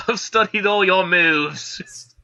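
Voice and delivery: put-on slow, drawn-out Australian-sounding accent; Australian-sounding mock Cockney. Host joins in voicing Scarlet's lines, botched Cockney turned Australian. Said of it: "That's Australian".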